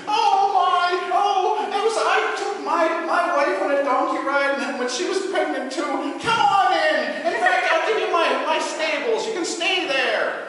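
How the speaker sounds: high, loud, hammed-up weepy gatekeeper; sobbing doorkeeper. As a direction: blubber and give in. Doorman weeping, relents.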